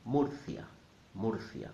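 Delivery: Murcia as MOOR-thya; Spanish U sound. Shown as a demonstration. Teacher modelling the U, Murcia as MOOR-thya. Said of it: "will sound like a double O".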